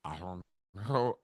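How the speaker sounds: low, soft and lost for words; stammering mumble. Retold or imitated. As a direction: mumble it, stuck on live TV. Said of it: "all I'm gonna be able to say".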